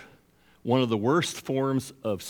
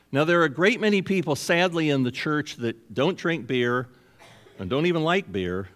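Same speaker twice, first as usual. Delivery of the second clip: normal talking voice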